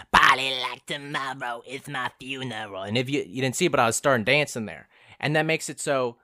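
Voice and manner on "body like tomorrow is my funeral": slow, rapped like [name] in character; slow [name] rap delivery; acting like [name]. Shown as a demonstration. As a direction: get into the character and perform it. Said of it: "you gotta act like [name]".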